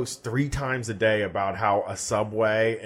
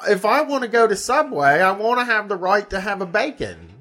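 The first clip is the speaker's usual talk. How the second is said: higher mock Aussie rant; Australian accent; 6 semitones higher than usual. Imitating Aussie poster ranting about bacon, Australian accent. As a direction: rant like an offended Aussie bloke. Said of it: "Did I do a good Australian accent first?".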